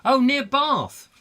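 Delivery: light up at the news; excited. Real, not acted. Reacting to the caller.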